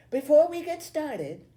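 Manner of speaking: high, loud classroom voice; teacher addressing class. A narrator's reading. Teacher opening homeroom after Billy sits, addressing class. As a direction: project brightly to get the class's attention.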